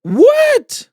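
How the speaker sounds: high shocked yelp; shocked shout. Real, not acted. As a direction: shout it in disbelief. Listener shocked.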